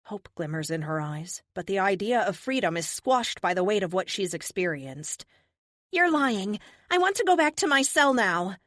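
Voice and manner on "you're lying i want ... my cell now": high and louder, shutting them out; high defiant refusal; surprisingly high voice. Acted. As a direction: push back, pitched high. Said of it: "Her voice is surprisingly high".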